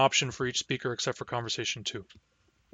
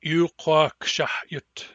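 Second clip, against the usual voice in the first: "shahyutsish" spelled out very slowly; "shahyutsish" stretched slow for learners; slow model word; much slower than usual. Shown as a demonstration. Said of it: "you will pronounce them with me".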